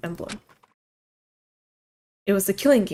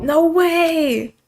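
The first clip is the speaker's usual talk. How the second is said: loud high outburst; excited shout; 8 semitones higher, 6 dB louder than usual. Acted. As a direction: yell it in disbelief. Art's outburst voiced.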